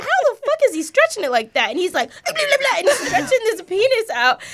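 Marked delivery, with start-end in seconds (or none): 0.0-1.7 shocked shout. Retold or imitated. Mom shocked by toddler's antics. 2.2-2.8 high babbling toddler noises, imitating her son; baby babble. Mom imitating her toddler son's weird noises. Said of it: "making weird noises".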